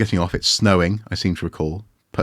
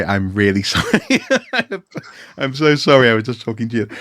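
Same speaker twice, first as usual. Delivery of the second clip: laughing apology; 4 semitones higher, 4 dB louder than usual. Pretend.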